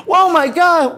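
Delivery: very high, loud shocked shout; shocked cry. Retold or imitated. Family seeing his mouth, shocked shout. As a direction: gasp it out in horror.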